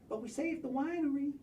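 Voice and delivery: ordinary talk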